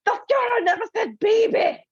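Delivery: very high, shrill, indignant outburst; imitating the furious ex-Nazi playwright; unhinged outraged rant. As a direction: storm the stage ranting like a mad playwright. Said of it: "this unhinged gentleman".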